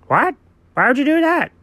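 high-pitched, appalled question; shocked. Pretend. Friend hears of slashing the squishmallows, high shocked protest.

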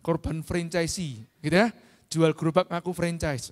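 ordinary delivery

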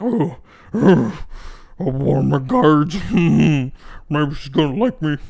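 put-on slow, leering old man voice; slowly ogle like a creepy boomer. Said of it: "they're like".

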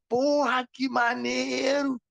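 high, slow gushing delight; mock-excited gushing; imitating an excited stranger. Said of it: "Aí o cara ficou empolgadão".

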